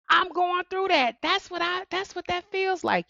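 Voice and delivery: high realizing voice; voicing a listener. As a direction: play the hurting listener recognizing it.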